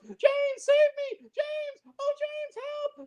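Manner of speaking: high, pleading falsetto cries for James; damsel in distress. Retold or imitated. Mocking the Bond girl crying out for James, high and pleading. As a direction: whimper for rescue like a helpless damsel. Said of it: "she goes".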